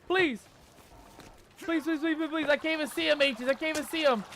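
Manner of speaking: high-pitched rapid begging; panicked pleading. Real, not acted. Player who can't see the attacker, panicked.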